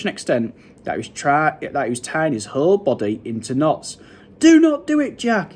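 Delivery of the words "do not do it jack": high, loud plea; screech. A narrator's reading. Giant begs Jack in his nightmare, screeching. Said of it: "he screeched".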